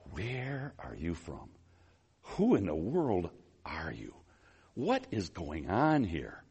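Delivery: slow, stunned near-whisper as Pilate; hushed frustration. Acted. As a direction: question him low and slow, in bewilderment. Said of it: "Pilate is so frustrated".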